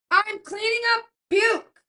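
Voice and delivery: high, loud, slow exasperated yell; exasperated shout. Retold or imitated. Mother yelling at staff offering food while she cleans puke. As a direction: shout it out, fed up and disgusted.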